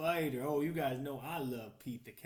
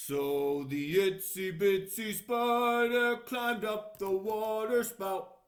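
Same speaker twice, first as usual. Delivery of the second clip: put-on slow, loud read-aloud for children; storybook reading; 5 semitones higher, 11 dB louder, slower than usual. A narrator's reading. Reading the book page aloud to children, slow and loud. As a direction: read it out big and slow for little listeners.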